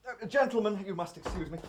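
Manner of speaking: ordinary talk, nothing marked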